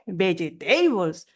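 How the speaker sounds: vegetables drawn out slowly in four syllables, be-ye-TEI-bols; mock the misread vegetables, every vowel sounded; Spanish-style mispronunciation. Shown as a demonstration. Baffled listener echoing the learner's error, slow four-syllable vegetables. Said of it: "tenemos cuatro sílabas".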